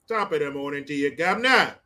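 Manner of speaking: quick hammed-up British greeting, ending on "governor"; mock British accent. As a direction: greet like a caricature Brit. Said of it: "You guys got a bunch of accents".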